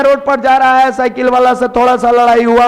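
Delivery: usual speaking voice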